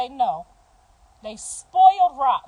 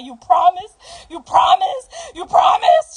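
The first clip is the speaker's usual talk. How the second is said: rising desperate shouted plea, louder each time; pleading shout; 6 semitones higher, 8 dB louder than usual. Retold or imitated. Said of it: "She said it like three times".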